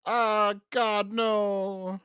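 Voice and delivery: high, drawn-out wail of mock dread; mock horror. Co-host hearing the Doctor will be sacrificed, mock horror. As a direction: overplay the horror, loud and high.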